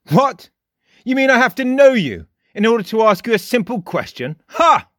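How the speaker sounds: high, loud mocking boy's voice; a shrill scoffing cry ending in a sharp ha. A narrator's reading. Frost scoffs at Jake's question, high and loud. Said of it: "cried the boy".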